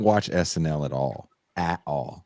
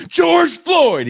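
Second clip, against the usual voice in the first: sudden high shout of the name; yelled answer; 10 dB louder than usual. Retold or imitated. Contestant shouts his answer on the game show, loud yell. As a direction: blurt it out loud like a quiz contestant. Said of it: "The guy yells out".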